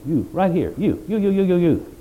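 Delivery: "you" repeated in quick high punches; stress on you. Shown as a demonstration. Preacher showing whom the verse addresses, emphatic "you". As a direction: hammer each "you" as if singling out listeners. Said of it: "emphatic, you".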